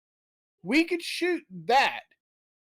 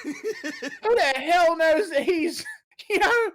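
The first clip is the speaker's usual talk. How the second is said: laughing; 6 semitones higher than usual. Real, not acted. Laughing at own joke.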